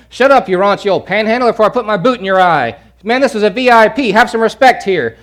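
loud, high scolding; rebuking crowd. Acted. Crowd scolding the blind beggar, loud and contemptuous. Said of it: "Many rebuked him".

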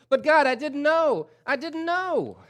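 high, loud, pleading wail; a sinner pleading at the judgment seat; desperate plea. Acted. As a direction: beg God for mercy, crying out. Sinner pleading before God.

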